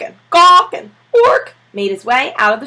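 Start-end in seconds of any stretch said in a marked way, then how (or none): 0.3-0.7 loud, high gawk squawk; penguin cry. A narrator's reading. Penguin crying out in Mister Popper's arms, loud squawk. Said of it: "amid cries of". 1.1-1.5 high, loud ork squawk; a squawking penguin; penguin cry. A narrator's reading. Penguin protesting being carried off, high squawk. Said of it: "amid cries of".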